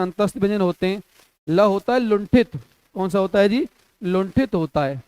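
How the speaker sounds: ordinary talk, nothing marked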